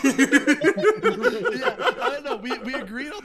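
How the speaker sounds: high, loud laughter through the words; laughing. Real, not acted. Cracking up while retelling the gun story, laughing hard. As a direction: laugh out the words, barely holding it together.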